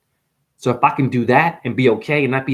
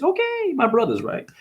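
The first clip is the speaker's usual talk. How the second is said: high, softer Indian-accented impression; lilting high put-on Indian accent; mock Indian accent; 12 semitones higher, 4 dB quieter than usual. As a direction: imitate an Indian priest's accent, pitched way up. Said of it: "they have the very good accent".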